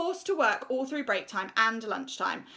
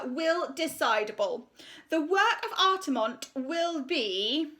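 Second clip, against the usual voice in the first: high, slow, self-important voice; pompous art teacher; 6 semitones higher, 5 dB louder, slower than usual. Acted. Art teacher announcing her decision to the school, pompous and high. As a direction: preen over the assembly. Miss Conceit addresses assembly.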